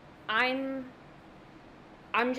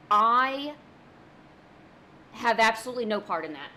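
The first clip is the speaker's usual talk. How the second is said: loud denial; 7 dB louder than usual. Acted. Mock denial to Toad.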